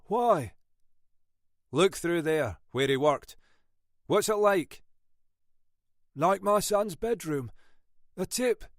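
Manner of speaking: gruff Scottish inspector, firm; lightly voiced Scottish detective. Munro questioning.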